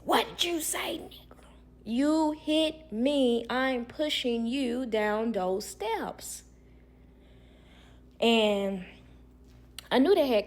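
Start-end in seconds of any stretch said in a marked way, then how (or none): 0.0-1.4 menacing whisper. Retold or imitated. Old man's menacing reply. 1.8-6.4 each word slow and spaced, whispered then voiced; slow deliberate threat. She repeats threat slowly.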